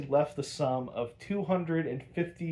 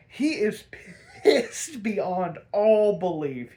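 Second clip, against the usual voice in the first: higher, louder, slow through laughter; laughing; 6 semitones higher, 5 dB louder, slower than usual. Real, not acted. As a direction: crack up while saying it.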